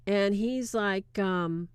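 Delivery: usual speaking voice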